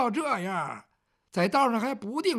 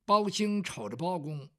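plain reading voice

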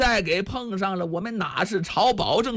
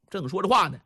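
ordinary delivery, nothing marked

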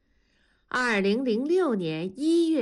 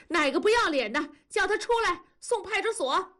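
high-pitched indignant call-out; acted high, scolding demand; 10 semitones higher than usual. A narrator's reading. Acted scolding outside a door.

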